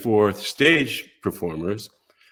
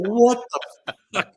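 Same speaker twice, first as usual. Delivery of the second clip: mock disbelief; 8 semitones higher than usual. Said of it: "I'm going".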